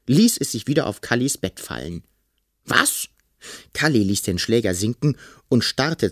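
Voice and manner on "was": very high squeak of disbelief; shocked. A narrator's reading. Boy learning the monster moves in, shocked high cry. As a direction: blurt it out sky-high.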